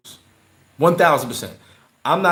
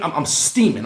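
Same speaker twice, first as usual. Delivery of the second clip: usual speaking voice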